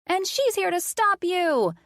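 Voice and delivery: put-on high, excited child's shriek. John shrieking while held by Colin. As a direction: squeal it like a thrilled little boy. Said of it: "John shrieked".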